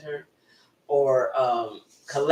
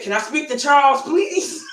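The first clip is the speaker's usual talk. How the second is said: cracking up; 9 semitones higher than usual. Retold or imitated. Imitating brother asking for a customer, cracking up. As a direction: squeal the polite request, fighting laughter.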